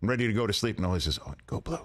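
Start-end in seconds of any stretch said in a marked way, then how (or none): 1.2-1.8 quiet whisper of go blue. Retold or imitated. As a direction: whisper it so nobody hears. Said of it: "What are you whispering for?".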